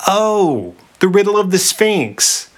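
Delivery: high, drawn-out "Oh" of realization; dawning recognition. A narrator's reading. Oedipus remembers the riddle, dawning recognition. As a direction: let it click, pitch lifted high. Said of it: "A look of vague recognition".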